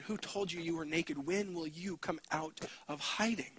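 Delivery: hushed tender appeal; soft invitation. Acted. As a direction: coax them out quietly. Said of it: "God is going to you and to your students and saying".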